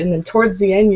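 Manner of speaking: ordinary talk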